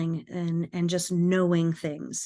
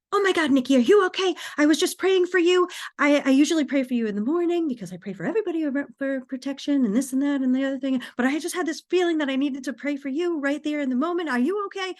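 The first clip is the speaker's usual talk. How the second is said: high, rapid worried-mom impression; frantic mother; 7 semitones higher, 3 dB louder, much faster than usual. Mother answering her daughter's call after praying, frantic. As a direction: pour it out in an anxious high-pitched rush. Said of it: "she sounded like this".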